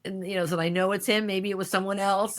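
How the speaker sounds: normal talking voice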